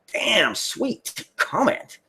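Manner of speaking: high, drawn-out "damn", savoring the praise; slow, high-pitched gushing thanks; delighted reaction. Real, not acted. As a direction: stretch it out slowly with pleased, high-pitched surprise. Delighted reaction to a flattering comment.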